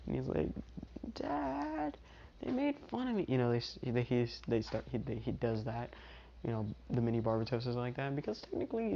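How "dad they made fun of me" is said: put-on tiny high whimpering kid voice; crying little child. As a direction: whine it softly, way up high. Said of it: "is starting to cry a little bit".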